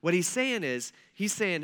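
ordinary talk

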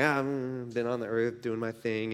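low, soft, evasive mumble; acted sheepish reply, dropped low; offhand Satan. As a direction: shrug off the question, quietly. Voices Satan answering God's question offhandedly.

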